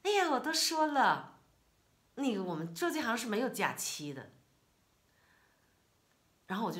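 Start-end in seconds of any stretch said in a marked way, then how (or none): 0.0-4.3 much louder and higher, exasperated; 哎呀 shouted high, then 做这行 pressed hard; irritated retort. Retold or imitated. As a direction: bark it out, still fuming. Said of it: "他还是气儿没过来".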